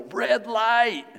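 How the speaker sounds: high, loud warning yell. Retold or imitated. People in the back seat yelling a warning at us. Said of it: "in the back seat to yell".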